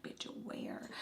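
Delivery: hushed, barely voiced quip; very soft whispered retort; whispered aside. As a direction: whisper it to a friend on the sly. Laughing aside to friend.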